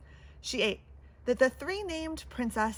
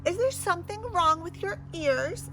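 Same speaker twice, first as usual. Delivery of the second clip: snapping; 6 semitones higher than usual. Acted. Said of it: "snapped the princess".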